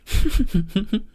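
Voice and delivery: easy demo chuckle; light chuckle. Shown as a demonstration. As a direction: give a quick light chuckle. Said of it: "that basic light chuckle".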